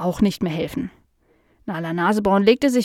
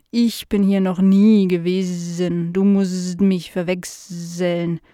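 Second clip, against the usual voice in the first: slow housefly with drawn-out buzzing s sounds; curious housefly; slower than usual. Acted. Fly meeting Nala for the first time, slow and buzzy. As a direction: stretch the words like a dopey, curious fly.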